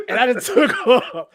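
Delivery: high laugh through the words. Real, not acted. Telling friends how he ran from the bus, laughing hard. Laughing retelling his escape.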